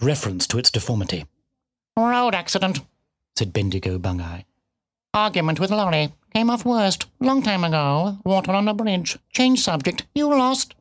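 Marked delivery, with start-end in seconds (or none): 2.0-2.8 higher-pitched, terse cat voice; bitten-off words. A narrator's reading. Cat tersely answering the piglet, words bitten off. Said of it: "seemed to bite off its words and spit". 5.1-10.7 higher-pitched, terse cat voice; bitten-off words. A narrator's reading. Cat tersely answering the piglet, words bitten off. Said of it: "seemed to bite off its words and spit".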